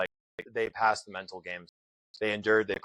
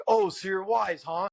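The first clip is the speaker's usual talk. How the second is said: mocking instructor; 9 semitones higher, 4 dB louder, slower than usual. Instructor taunting him in story.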